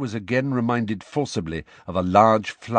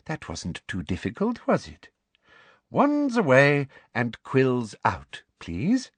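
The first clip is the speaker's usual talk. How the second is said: sugary, condescending teacher; sweet instructions lifting high at the end; sweetly; 6 semitones higher, slower than usual. A narrator's reading. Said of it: "said Professor Umbridge sweetly".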